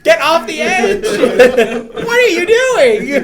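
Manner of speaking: high, loud laughing shout; laughing yell. Real, not acted. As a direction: shriek it through laughter. Yelling at character on edge.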